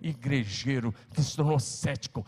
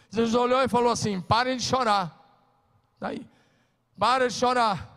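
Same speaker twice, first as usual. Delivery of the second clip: loud, high preaching that builds to a shout; fiery preaching; 7 semitones higher, 5 dB louder than usual. Acted. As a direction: rouse the church, climbing higher. Pastor preaching at full force.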